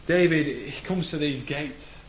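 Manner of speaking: normal talking voice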